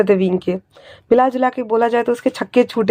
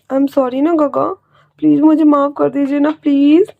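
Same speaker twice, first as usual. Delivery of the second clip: nervous pleading; 4 semitones higher than usual. Acted. Wing begs his uncle to forgive him, nervous and higher.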